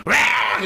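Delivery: loud, high imitated retch; retching roar. Newcomer vomiting during prayer, loud mock retch. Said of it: "오바이트 해봐요".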